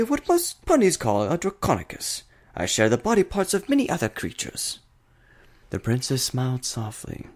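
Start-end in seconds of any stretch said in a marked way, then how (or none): 0.0-4.8 much higher, bright cheerful creature voice; cheerful Discord. A narrator's reading. Discord cheerfully explains what he is to the princess. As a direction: show off his odd body gleefully. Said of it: "replied cheerfully".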